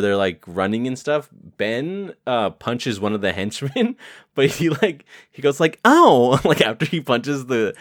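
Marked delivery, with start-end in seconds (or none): 5.9-6.4 high loud ow; mock pained ow; mock yelp. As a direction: yelp like a hit hand. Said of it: "literally says like that".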